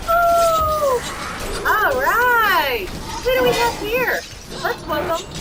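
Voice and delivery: excited cheer. Real, not acted. Cheering, then greeting a new boss.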